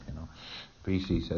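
normal talking voice

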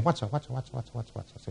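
soft repeated murmur, fading away to nearly nothing; puzzled repeating. Acted. Lecturer voicing the patient's inner searching, puzzled and fading. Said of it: "He's saying".

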